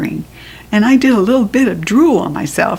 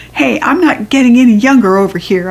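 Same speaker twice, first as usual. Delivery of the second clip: fast, louder impatient call; impatient shout; 3 semitones higher, 5 dB louder, faster than usual. A narrator's reading. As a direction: hurry her up. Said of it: "I shouted".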